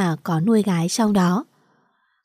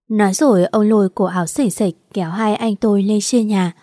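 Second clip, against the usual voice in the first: usual speaking voice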